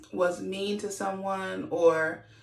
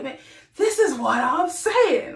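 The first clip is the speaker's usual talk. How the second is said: raised, high passionate voice; Kyle; 7 semitones higher, 5 dB louder than usual. Retold or imitated. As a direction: imitate an impassioned husband, loud and pitched up. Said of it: "his voice is gonna naturally go up".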